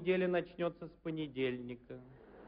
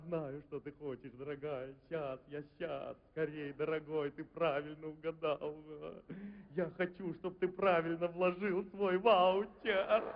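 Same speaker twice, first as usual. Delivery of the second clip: seductive ad voice; 3 semitones lower than usual. Pretend. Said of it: "рекламы просто возбуждают".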